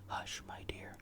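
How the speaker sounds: ordinary delivery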